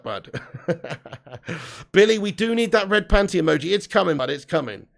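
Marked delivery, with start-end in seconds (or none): 0.4-1.9 quiet chuckling laugh; a soft burst of chuckles. Real, not acted. Laughing at the chat.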